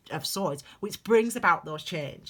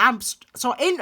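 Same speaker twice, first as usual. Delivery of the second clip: ordinary talk, nothing marked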